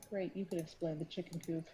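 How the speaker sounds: a low sour grumble; muttering. Acted. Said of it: "Lana mutters".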